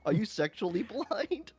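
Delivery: ordinary talk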